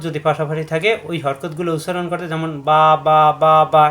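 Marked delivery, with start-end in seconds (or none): 3.1-3.8 বা, বা, বা chanted loud and stretched as eight beats; বা বা বা said loudly in one long drawn count; eight harakat drawn. Shown as a demonstration. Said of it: "এভাবে আটটা হরকত উচ্চারণ করতে".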